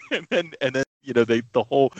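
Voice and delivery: ordinary delivery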